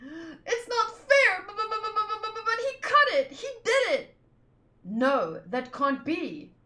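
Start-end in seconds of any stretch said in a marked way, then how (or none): 0.0-4.2 put-on tearful child shouting through sobs; crying protest. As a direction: sob it out like a wronged child.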